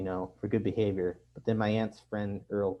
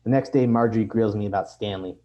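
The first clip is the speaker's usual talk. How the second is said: usual speaking voice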